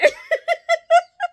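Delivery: real high laughing burst; high burst of laughter. Real, not acted. Telling friends the story, real laughing burst. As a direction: break into laughter.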